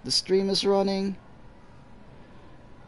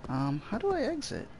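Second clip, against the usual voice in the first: usual speaking voice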